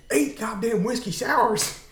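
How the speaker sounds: high-pitched mock yell; retold yelling. Actor alone in the dressing room berates himself, retold yell. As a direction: imitate a furious self-scolding outburst.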